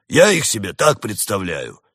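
loud, insistent bear voice; defiant bear retort; stubborn bear. A narrator's reading.

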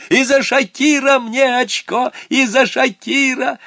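put-on high, loud, slow cry of a boy claiming his point; high shout. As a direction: shout it up high, stretching each word. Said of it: "И тут Саня закричал". (громкий высокий крик)

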